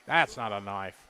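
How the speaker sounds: low, quiet Crocodile Dundee line; a lightly voiced outback bushman, deadpan. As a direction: drop the voice low and cool for the knife line. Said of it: "Crocodile Dundee style".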